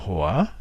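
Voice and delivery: "hoa" dipping, then swinging up; questioning upturn on "hoa"; interrogative tone. Shown as a demonstration. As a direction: draw "hoa" out into a rising tone. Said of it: "un accent interrogatif sur le mot hoa".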